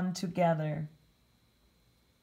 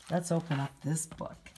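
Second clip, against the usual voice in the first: ordinary delivery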